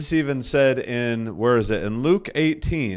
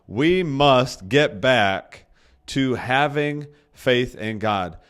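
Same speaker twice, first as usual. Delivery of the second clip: slow emphatic; much slower than usual. Real, not acted. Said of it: "listen to that".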